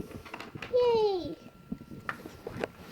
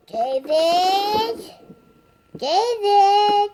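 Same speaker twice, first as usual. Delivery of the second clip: loud call of the name, insistent; calling out loudly; 9 dB louder, much slower than usual. Real, not acted. Child calling to David behind the camera, loud insistent calls. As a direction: yell across the room to get his attention.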